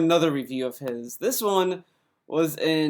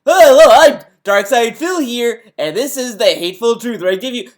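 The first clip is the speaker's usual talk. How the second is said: higher, loud exaggerated show-host intro; fake presenter voice; 6 semitones higher, 8 dB louder than usual. Mocking a game reviewer's intro, in a fake presenter voice. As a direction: put on a phony, booming reviewer's announcement. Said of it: "a presentator voice".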